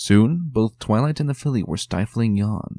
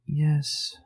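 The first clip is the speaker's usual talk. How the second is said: shaky answer; 7 semitones higher than usual. A narrator's reading. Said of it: "the filly shakily answered".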